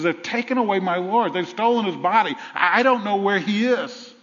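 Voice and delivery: Mary at the tomb, upset and pleading; distressed plea. Acted. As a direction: plead in anguish for the lost body. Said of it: "What she says is".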